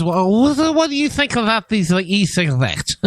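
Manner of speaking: teasing mimicry of the question; mocking imitation. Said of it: "you sounded like this".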